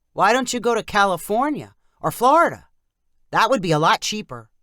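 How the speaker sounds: a loud, pushy uncle; uncle's voice. Acted.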